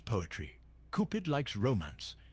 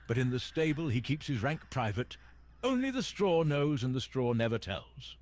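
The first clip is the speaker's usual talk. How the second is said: ordinary delivery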